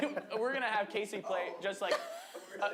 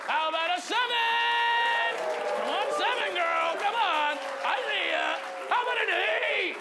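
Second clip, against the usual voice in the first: hype host counting up; 10 semitones higher, 7 dB louder than usual. Real, not acted. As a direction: pump the crowd up, louder each number. Hyping up crowd, counting up.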